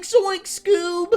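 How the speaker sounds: very high, loud cartoon voice; Shaggy. Streamer doing three Shaggy lines, high and loud. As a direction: yelp it like a spooked cartoon slacker. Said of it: "I can do Shaggy".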